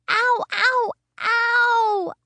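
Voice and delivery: high loud ows; yelling. Acted. Girl yelping at Mother checking her toe, high and loud. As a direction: yelp out the pain. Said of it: "I yelled again".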